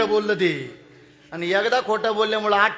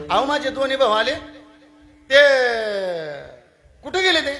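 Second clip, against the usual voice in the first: high, louder asking after the brothers; raised question; 5 semitones higher, 5 dB louder than usual. Acted. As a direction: ask where they went, high and loud. Eldest son asks after brothers. (मोठा मुलगा भावांबद्दल विचारतो, उंच आणि मोठ्या आवाजात)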